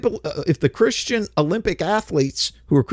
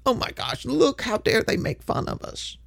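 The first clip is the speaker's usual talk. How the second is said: high, soft mocking whine of offended believers; whiny mockery; 9 semitones higher, 6 dB quieter than usual. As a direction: mimic a prissy complainer, pitched up. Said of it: "whine about it".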